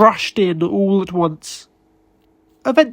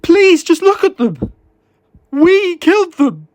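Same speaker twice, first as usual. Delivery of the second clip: high, loud, trembling plea; begging through a quavering, breaking voice; shaking voice; 9 semitones higher, 6 dB louder than usual. A narrator's reading. David pleading with co-workers to look at the bodies, voice shaking. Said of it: "his voice shaking".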